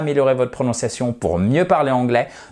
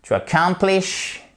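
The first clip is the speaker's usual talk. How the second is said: "accomplish" with a high, stressed COM; second-syllable stress; 6 semitones higher than usual. Shown as a demonstration. Teacher warning learners, "accomplish" stressed on the second syllable. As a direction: lift the pitch on COM in "accomplish". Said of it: "Accent tonique ici sur la deuxième syllabe".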